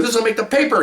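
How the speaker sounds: high, eager retold exclamation; excited chief. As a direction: mimic a chief delighted at making headlines. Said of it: "He's all excited".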